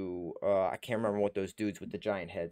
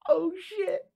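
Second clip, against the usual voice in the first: amazed squeak. Real, not acted. Fan expects Tobey Maguire.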